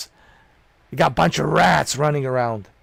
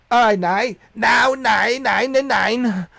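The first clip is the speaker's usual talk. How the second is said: lightly hammed German accent, higher and louder; mock German accent; 9 semitones higher, 4 dB louder, slower than usual. Shown as a demonstration. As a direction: say nine like a joking German. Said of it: "Starting to sound like a German".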